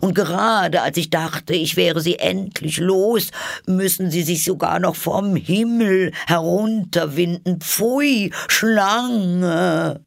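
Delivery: high rant rising to a loud screech. A narrator's reading. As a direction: build to a squawked "Pfui, Schlange!". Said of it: "fuhr die Taube mit erhobener Stimme fort".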